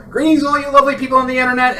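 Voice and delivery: grand announcer. Pretend. Mock grand greeting.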